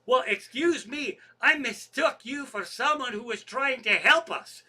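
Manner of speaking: high, loud mock-offended comeback; retold huffy sarcasm. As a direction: throw the indignant reply back, imitating the manager. Manager's huffy comeback in retold quarrel.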